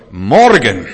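sudden loud ringing shout; God's proclamation. Acted. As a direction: proclaim it as God's booming command. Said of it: "dann sagt Gott". (lauter Ausruf als Gotteswort)

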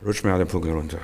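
low, soft private retort; not out loud. Acted. Preacher showing his silent answer when provoked, under the breath. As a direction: keep it to yourself, very quiet and low. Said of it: "Das muss nicht laut sagen".

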